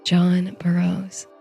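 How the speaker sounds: ordinary delivery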